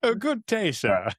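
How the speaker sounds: jokey put-on British "good day, sir"; mock British accent. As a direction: answer in a comic British gentleman voice. Mocking British greeting back.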